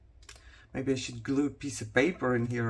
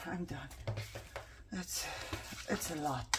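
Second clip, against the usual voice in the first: tired whisper; 11 dB quieter than usual. Real, not acted. Exhausted sigh of giving up.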